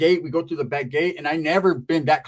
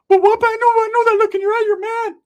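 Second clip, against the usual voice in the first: very high comic voice, starting loud; cousin's put-on voice; 8 dB louder than usual. Cousin accusing the jealous teller, high squawk. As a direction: protest in a high squeal. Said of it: "Why does he talk like that?".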